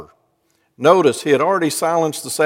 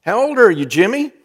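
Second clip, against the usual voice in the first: cheery solicitor; 7 semitones higher than usual. Retold or imitated. Solicitor talking to child.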